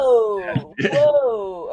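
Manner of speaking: high, loud, startled whoa; stunned whoa. Real, not acted. Realizing how the character died, stunned and startled. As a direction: react as if it just hit you. Listener stunned by the double-tap meaning.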